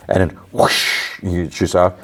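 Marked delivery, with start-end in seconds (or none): 0.5-1.2 drawn-out airy whoosh, no voice; imitated whoosh. Retelling the Doctor's launch through space, imitated whoosh. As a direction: imitate a rush of escaping gas.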